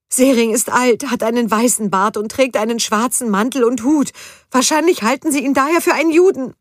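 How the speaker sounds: high, loud anxious explanation; agitated, shrill. A narrator's reading. Judith explaining to Gunther why the mob attacks Sering, high loud agitated. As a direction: talk fast to convince him, high and strained.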